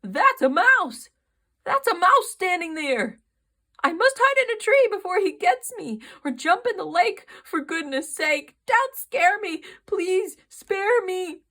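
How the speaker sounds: frightened elephant begging the mouse; panicked elephant. A narrator's reading. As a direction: scream for mercy, rushing.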